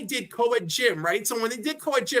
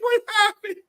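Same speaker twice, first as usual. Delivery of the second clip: dazed confusion; 12 semitones higher, 4 dB louder than usual. Retold or imitated. Waking up after fainting.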